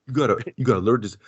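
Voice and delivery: normal talking voice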